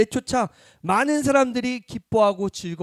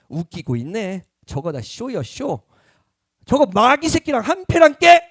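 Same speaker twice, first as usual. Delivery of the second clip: scornful Pharisee sneer; 3 semitones higher, 7 dB louder than usual. Retold or imitated. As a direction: jeer at the miracle as a scam, then bark the insult. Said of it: "이렇게 젠틀하게 얘기하지 않았어요". (바리새인이 비웃다가 크게 욕하듯)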